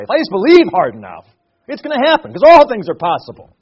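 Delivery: lightly mocking prosperity-gospel hype; mock prosperity preacher. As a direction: mimic a prosperity preacher's loud promise. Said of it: "the health and wealth gospel".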